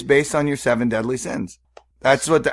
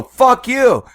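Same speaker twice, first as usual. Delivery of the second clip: high, loud mock outburst; retold angry yell; 11 semitones higher, 6 dB louder than usual. Retelling turning round on three guys, angry yell. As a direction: act out yelling back at someone. Turns around and confronts the guys.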